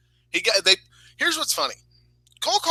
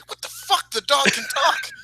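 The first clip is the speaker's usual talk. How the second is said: mock shocked; 8 semitones higher, 3 dB quieter than usual.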